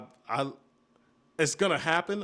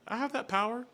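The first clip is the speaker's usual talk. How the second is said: high-pitched retort; 9 semitones higher than usual. Retold or imitated. Mock reply to niece's question.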